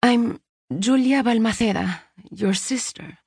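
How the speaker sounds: lightly voiced stranger repeating herself; gentle repeat. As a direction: repeat it calmly, not pushing. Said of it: "the woman repeated, but not with insistence".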